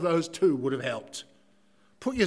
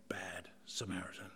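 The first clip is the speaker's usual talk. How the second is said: hushed whisper on bad Samaritan; conspiratorial sermon whisper; 10 semitones lower, 17 dB quieter than usual. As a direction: drop to a whisper for effect. Dramatic drop on "bad.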